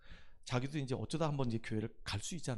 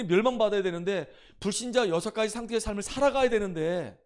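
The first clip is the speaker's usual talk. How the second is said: raised, loud preaching voice; forceful preaching; 8 semitones higher, 10 dB louder than usual. Acted. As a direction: preach it to the congregation with full force. Pastor preaching to congregation. (힘차게 높여 외치는 설교)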